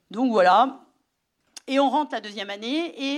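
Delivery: normal talking voice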